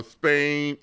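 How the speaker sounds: ordinary delivery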